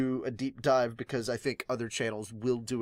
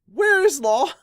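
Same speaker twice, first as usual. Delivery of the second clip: much higher, louder exasperated question; exasperated call; 10 dB louder than usual. Real, not acted. Fan asking the audience where Law is, much higher and louder.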